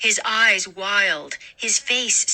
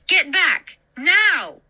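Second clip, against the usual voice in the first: high, louder scream; 9 semitones higher, 3 dB louder, much slower than usual. A narrator's reading. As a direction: Get back!, yelled at the imposter. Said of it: "she screamed".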